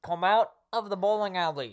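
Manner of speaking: loud, high police bullhorn order; through a megaphone. Pretend. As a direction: order them out like a cop.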